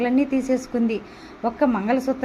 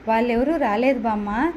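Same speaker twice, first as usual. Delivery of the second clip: plain reading voice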